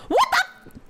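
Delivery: sudden high startled outburst; shocked. Real, not acted. Streamer reacting to the doctor, shocked and high. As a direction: caught off guard, voice jumps up.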